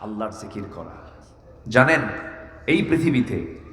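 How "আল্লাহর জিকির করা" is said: much softer, lower reply; hushed answer. Real, not acted. Preacher answers his own question for listeners, hushed reply. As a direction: drop right down to give the answer.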